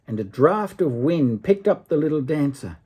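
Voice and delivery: plain reading voice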